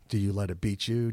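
ordinary delivery

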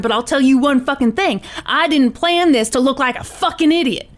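acted gunman laying down threats, emphatic but not shouting; Tony.

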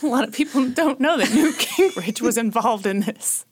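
laughing through words. Real, not acted. Joke about Newt Gingrich.

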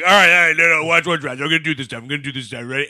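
put-on loud, drunk bragging guy; drunk show-off. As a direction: hype yourself up like a drunk. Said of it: "Jake Gyllenhaal is wasted".

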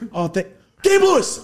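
high, loud name yelled through laughter; laughing yell. Retold or imitated. Retelling yelling the actor's name, laughing yell. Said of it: "I just yelled his fictional name".

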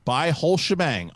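usual speaking voice

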